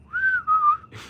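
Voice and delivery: shrieking laugh; high squeal laugh. Real, not acted. Laughing at the F A F O line.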